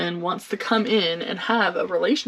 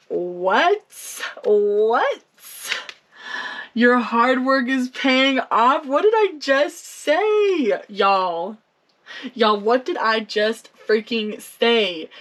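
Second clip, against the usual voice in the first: real delighted astonishment; amazed disbelief; slower than usual. Real, not acted. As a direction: react like the card just proved you right. Card matches her earlier prediction.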